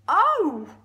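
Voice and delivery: loud, drawn-out cry of pain; elephant's bellowed oh. Acted. Elephant bitten on the leg, big loud oh. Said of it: "in his big, deep voice".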